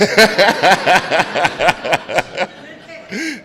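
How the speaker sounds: big infectious laugh at the wife joke; real laugh. Real, not acted. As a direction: crack up at your own line. Laughs after "except my wife.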